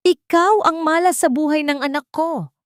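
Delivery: high, loud furious shout at her daughter-in-law; furious yell. A narrator's reading. Mother-in-law yelling at Teresa, furious shout. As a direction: bellow the blame at her. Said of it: "Muling bulyaw ni Imelda".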